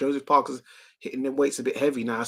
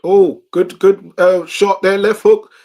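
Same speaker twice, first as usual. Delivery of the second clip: loud, higher burst of live call; excited shout; 4 semitones higher, 11 dB louder, slower than usual. Real, not acted. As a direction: yell it as the punch lands. Big punch lands in the fight.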